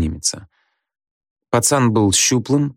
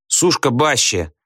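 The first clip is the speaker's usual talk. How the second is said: higher, slower mock shout; heckling call; 5 semitones higher, slower than usual. A narrator's reading. Back-row pupils mock the teacher's surname, calling out. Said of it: "крикнули с задних парт".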